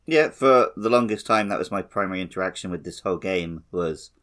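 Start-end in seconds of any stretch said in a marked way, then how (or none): none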